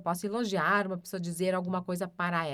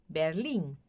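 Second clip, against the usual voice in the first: usual speaking voice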